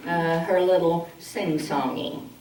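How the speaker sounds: ordinary talk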